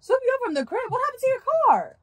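loud, high-pitched disbelief; incredulous. Acted. Driver reacting to friend asking for a pickup, incredulous. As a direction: question him loudly, pitch jumping up.

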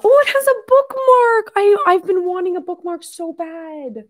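very high-pitched delighted squeal; excited squeal. Real, not acted. Reader discovering her new book has a bookmark, excited squeal. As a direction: squeal with real delight, stretching out the end.

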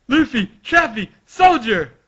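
high, loud dramatic shout; anime-style urgent call. Acted. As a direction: shout each name to get their attention. Acted calling out names.

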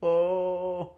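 ordinary talk

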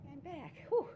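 puffing after carrying candles; breathless from walking back and forth; out of breath. Real, not acted. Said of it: "I'm out of breath".

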